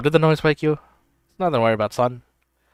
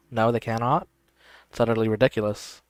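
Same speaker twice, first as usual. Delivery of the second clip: normal talking voice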